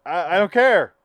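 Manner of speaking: blunt honesty. Retold or imitated. Man telling the lady his honest truth, loud and blunt. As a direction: blurt it out as the fed-up listener. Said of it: "go the honest truth of".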